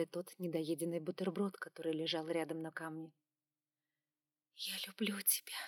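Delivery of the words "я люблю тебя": soft unvoiced whisper. A narrator's reading. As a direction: breathe it in a whisper. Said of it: "прошептала я".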